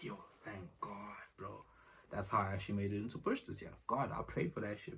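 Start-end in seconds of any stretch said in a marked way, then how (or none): 0.0-1.7 slow, hushed thank-you; softer, slower words of relief; relieved. Retold or imitated. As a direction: breathe it out in sheer relief. Relief after getting ticket.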